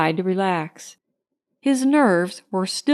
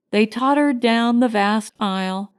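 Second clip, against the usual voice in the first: plain reading voice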